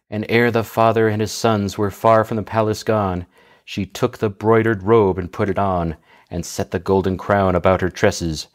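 normal talking voice

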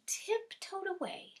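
high, soft storyteller's tiptoe; hushed tiptoe narration. A narrator's reading. Storyteller as the littlest goat sneaks away, hushed. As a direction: tell it softly, as if sneaking.

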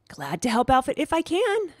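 high, eager hamster voice; squeaking small pet. A narrator's reading. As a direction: squeak it out helpfully. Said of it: "I squeaked to her".

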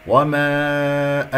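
وما with a long held aa; drawn-out recited وما; madd demonstration. Shown as a demonstration. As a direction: stretch the final vowel of وما very slowly. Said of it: "هنا مد جائز منفصل".

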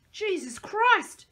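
slow, high screamed curse; high horrified scream, drawn out. A narrator's reading. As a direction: scream it out in horror. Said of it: "Jimmy screamed".

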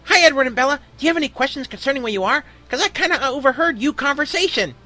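high, eager cartoon voice; squeaky-high cartoon kid, eager to help; SpongeBob. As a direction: chirp the greeting and questions brightly high. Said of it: "He trailed off".

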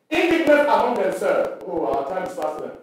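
ordinary talk, nothing marked

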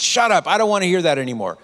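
high shout easing into fast protest; retold angry shout. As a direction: snap it out, flustered and angry. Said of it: "he just shouted loudly".

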